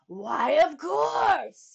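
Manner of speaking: loud, high, slow triumphant cry; excited shout. Acted. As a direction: bellow with sudden glee. King's sudden idea.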